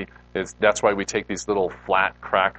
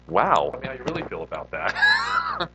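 laughing sarcastic retort; 5 semitones higher than usual. Retold or imitated. Joking reply to missionary's no.

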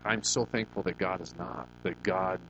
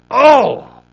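sudden loud high disgusted Oh; repulsed cry; 21 dB louder than usual. Retold or imitated. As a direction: blurt it out at the sight of the mess. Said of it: "I remember going in there going".

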